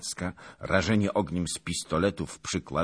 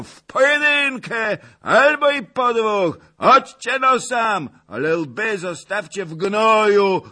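booming, much higher and louder boast; thundering voice; 13 semitones higher, 9 dB louder than usual. Acted. Zagłoba roaring at the cossacks from the loft, thunderous. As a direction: roar defiance like an old brawler. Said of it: "grzmiący głos pana Zagloby".

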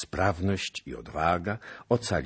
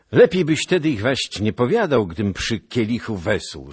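louder, higher, irritated rebuke; displeased prince; 6 semitones higher, 10 dB louder than usual. A narrator's reading. Prince rebuking Kuszel at the feast, irritated and loud. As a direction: scold the bearer of bad news. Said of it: "rzekł książę".

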